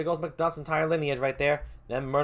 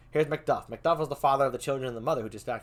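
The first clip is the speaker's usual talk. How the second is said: ordinary delivery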